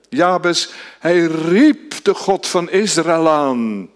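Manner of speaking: fervent pulpit delivery; impassioned preaching. Acted. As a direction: preach it with fervor, building. Preaching at full force.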